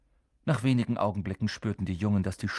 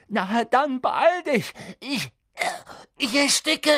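choked out; 13 semitones higher, 4 dB louder than usual. Acted. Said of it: "würgte Harry hervor".

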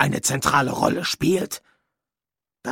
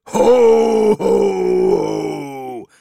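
booming Santa laugh, much louder, first ho higher; 7 semitones higher, 6 dB louder, much slower than usual. Pretend. Death overdoing the Santa laugh at every line. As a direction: tack on a big hearty ho ho ho. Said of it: "du brauchst nicht ständig".

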